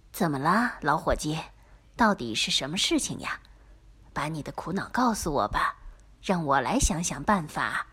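soft, low, caring voice; gentle sympathetic. A narrator's reading. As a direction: comfort a sad friend softly. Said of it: "最后很轻的、充满同情心地说". (轻声而充满同情)

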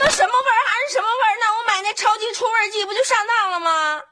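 very high, agitated outburst; indignant complaint. Acted. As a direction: wail that you've been had. Wife realizes she was cheated. (气急败坏地高声抱怨)

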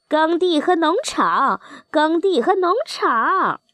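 high-pitched goose call from the air. A narrator's reading. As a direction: call it out high, like geese answering from the flock. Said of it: "齐声叫道". (高声叫喊)

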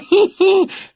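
high smug witch cackle; a gleeful little laugh. A narrator's reading. Wanda cackling at her rivals, high.

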